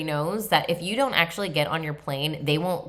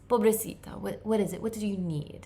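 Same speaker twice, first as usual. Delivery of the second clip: put-on Spanish accent; 5 semitones higher, 4 dB quieter than usual. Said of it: "he's talking to me in, like, a Spanish accent".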